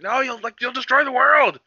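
high, rising mock plea; hammed-up high-pitched warning; Gohan impression. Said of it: "Gohan was like".

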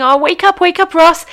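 high, loud mock rousing; playful wake-up call. Host to sleepy listener Ross, playfully loud wake-up call.

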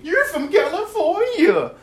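high, slow mocking squeal; mock the doubters in a squeaky put-on voice; a sneering fake high voice. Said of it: "these fools think".

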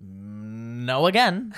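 teasing slow no; slow drawn-out no. Real, not acted. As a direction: stretch it very slowly. Drawn-out denial.

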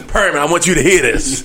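laughing, hyped wrestling promo callout. Retold or imitated. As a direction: call him out like a wrestler on the mic. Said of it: "he cut a wrestling promo".

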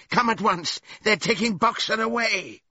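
high, loud cry of alarm; urgent shout. A narrator's reading. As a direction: raise the alarm at the top of the voice. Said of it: "he shouted".

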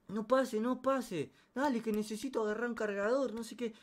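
high-pitched acted female voice; imitated girl. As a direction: call through the door in a high mock voice. Girl answering brother through door.

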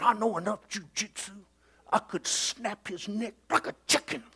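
deadpan menace, quietly; Todd's voice. Retold or imitated. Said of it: "he talked like this".

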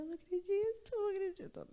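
high, choked-up "I can't believe it"; retelling a teary, high-pitched disbelief; tearful disbelief. Retold or imitated. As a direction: replay the moment the tears started. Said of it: "Eu comecei a chorar".

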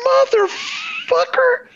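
a long, pained, high-pitched motherfucker; strangled high curse. Retold or imitated. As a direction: let it out like the shed betrayed you. Retells cursing at mistake.

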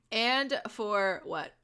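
"and for what", stretched out slowly; slow and deliberate. Real, not acted. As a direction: draw out each word. Repeats the phrase slowly, deliberately.

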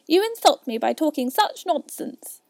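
high, loud rebuke; indignant mouse complaint; offended mouse. A narrator's reading.

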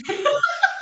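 high-pitched little laugh; short burst of high giggles; risada aguda. Retold or imitated. Reação encenada antes da resposta. (risadinha bem aguda)

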